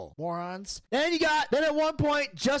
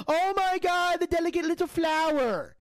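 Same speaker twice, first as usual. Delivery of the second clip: mocking fuss; 5 semitones higher than usual. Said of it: "everybody has to ... go".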